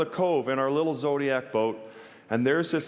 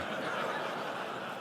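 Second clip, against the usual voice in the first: laugh; 10 dB quieter than usual. Real, not acted. Laughs at the seal feeding him.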